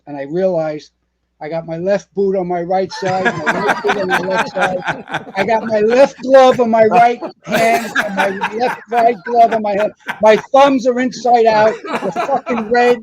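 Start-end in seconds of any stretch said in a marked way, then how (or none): 3.2-13.0 higher, louder laughing retelling; laughing at the rookie blunder; laughing at himself. Retold or imitated. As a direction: laugh through the rookie mix-up. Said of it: "I was a nervous wreck".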